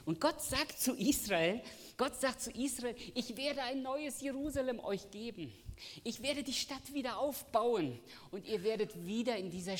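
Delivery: high, loud preaching voice, driving the promise home; full-force preaching. Acted. Preacher proclaiming God's promise to the church, high and loud. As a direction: preach it out to the congregation.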